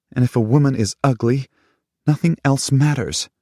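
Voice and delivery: high, loud, fast angry tirade; shouting her hurt at him; furious outburst. A narrator's reading. Lover furiously accusing him.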